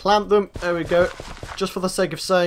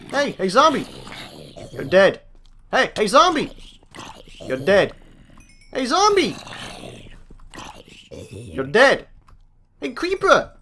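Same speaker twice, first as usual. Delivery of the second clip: taunting call-out; 2 semitones higher than usual. Pretend. Player hailing zombies before killing them, taunting call-out. Calling out to mobs while killing.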